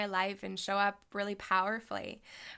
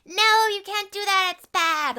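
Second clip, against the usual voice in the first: much higher, louder scolding inner voice; 12 semitones higher, 12 dB louder than usual. Retold or imitated. Solar plexus voice scolding her for loving money, loud. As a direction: let the inner critic shout it down. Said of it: "this thing in my solar plexus that's like".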